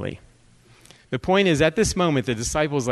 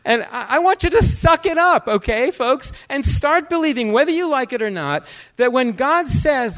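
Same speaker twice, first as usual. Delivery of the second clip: high, raised preacher's voice; impassioned, insistent preaching; forceful exhortation; 10 semitones higher, 4 dB louder than usual. Real, not acted. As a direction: urge the congregation emphatically. Exhorts the congregation.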